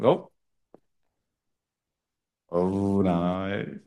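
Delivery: ordinary delivery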